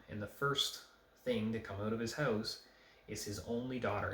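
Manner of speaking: ordinary talk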